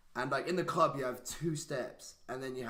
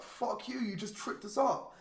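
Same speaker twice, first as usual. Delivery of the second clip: angry retort; 9 semitones higher than usual. Retold or imitated. Angry at guy who tripped him.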